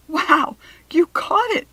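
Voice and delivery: high, loud amazed cry; excited cry. A narrator's reading. Rachel thrilled at Kirsty's catch, crying out. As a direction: burst out in delight. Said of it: "Rachel cried".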